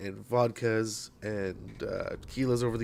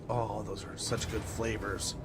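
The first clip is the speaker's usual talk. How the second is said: savoring sigh; 2 semitones lower, 9 dB quieter, slower than usual. Retold or imitated. Savoring an imagined evening sip.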